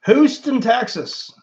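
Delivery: Houston jumps high and loud, then settles; delighted repeat of the city; excited. Real, not acted. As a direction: say Houston like it thrills you.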